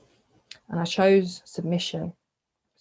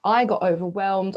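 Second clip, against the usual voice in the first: ordinary talk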